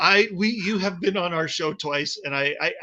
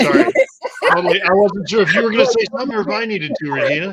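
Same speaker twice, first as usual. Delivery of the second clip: flustered rush; 2 semitones higher, 10 dB louder, faster than usual. Real, not acted. Flustered apology over awkward overlap.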